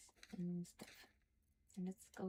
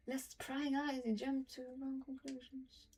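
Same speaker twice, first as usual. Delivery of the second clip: reading a journal entry aloud, a little higher, measured; diary reading; 3 semitones higher, 4 dB quieter than usual. Acted. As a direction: read it like a private diary, steady and serious. Reads Halsin's diary aloud.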